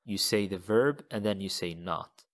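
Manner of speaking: ordinary delivery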